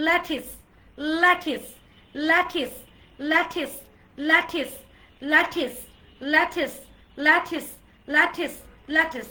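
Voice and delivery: ল্যাটিস as loud, short "LA-tis" repeats; corrected lettuce. Shown as a demonstration. Teacher drilling the class, corrected lettuce pronunciation. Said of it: "So it's not লেটুস".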